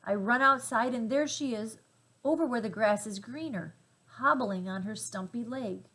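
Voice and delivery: ordinary delivery, nothing marked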